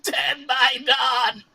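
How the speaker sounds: high, drawn-out monster shriek; gleeful ghoul. Acted. Dying ghoul taunting the one who felled it, shrill gleeful chant. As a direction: screech it slowly like a cackling ghoul. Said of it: "still laughing, and it says".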